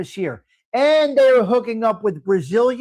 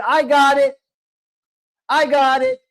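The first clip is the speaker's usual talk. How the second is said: calling out to answer; 5 semitones higher, 4 dB louder than usual. Retold or imitated. Family member grabbing ringing phone.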